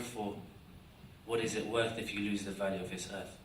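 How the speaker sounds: ordinary delivery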